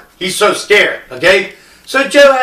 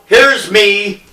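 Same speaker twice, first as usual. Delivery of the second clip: ordinary delivery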